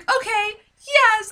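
high, loud relieved yes; hammed-up overjoyed yes; mock tearful yes. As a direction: gush it through happy tears. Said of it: "And then she's like".

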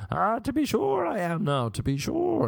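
high, hammed-up leprechaun brogue; leprechaun Irish accent. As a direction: overdo the Irish like a cartoon leprechaun. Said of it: "we kinda like this leprechaun out little accent".

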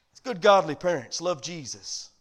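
ordinary talk, nothing marked

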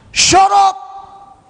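very high, loud shouted command; explosive yell to silence a demon; harsh rebuke. Acted. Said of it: "like, harsh".

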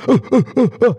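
high chopped uh sounds, each cut off; choppy uh pops. Shown as a demonstration. Showing Chris the wrong choppy way, cut uh sounds. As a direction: chop the air into separate uh bursts. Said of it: "You're not going".

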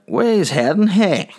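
high, loud country cabbie call; country taxi driver. Retold or imitated. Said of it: "that's country folk".

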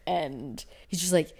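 normal talking voice

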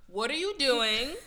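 loud, slow, pointed questioning; suspicious interrogation. Acted. Rider challenging a suspicious man in a trench coat, loud interrogation. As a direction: grill them word by drawn-out word.

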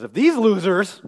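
loud, high jab of scorn; puffed-up, scornful Peter; prideful scorn. Acted. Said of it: "that's pride".

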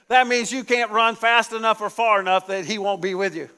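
usual speaking voice

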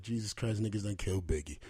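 a hint of the tears that night; retold grief, voice sunk and soft; crying. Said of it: "I was crying in my room".

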